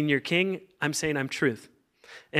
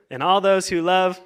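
raised pitch, louder, left hanging; rousing cue; 4 semitones higher, 5 dB louder than usual. Shown as a demonstration. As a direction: call it out high for the crowd to finish. Cues congregation to answer.

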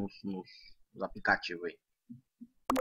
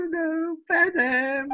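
high, drawn-out fake sobbing wail; mock crying; 10 semitones higher, slower than usual. Gamer lamenting a lost round, hammed-up crying. Wailing over losing the game.

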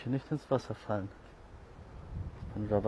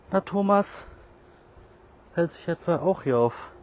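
excited greeting; 6 semitones higher, 8 dB louder than usual. Real, not acted. Greeting found cat loudly.

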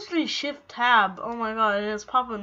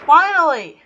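excited shout; 5 semitones higher, 11 dB louder than usual. Real, not acted. Relief after long wait.

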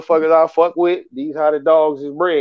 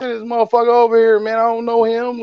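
higher, fast annoyed rant at a friend; retold cussing out; 6 semitones higher, faster than usual. To his homeboy over a caller, a higher fast angry rant. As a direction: tell off the partner who sent a time-waster. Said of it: "I started to cuss him out".

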